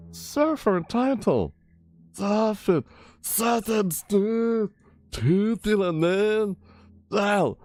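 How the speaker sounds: high, slow surfer dude. Acted. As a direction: squeak it like a surfer. Reading surfer items.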